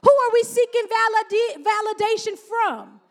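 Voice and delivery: loud, high, driving preacher cadence; full-force preaching. Acted. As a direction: hammer each question home from the pulpit.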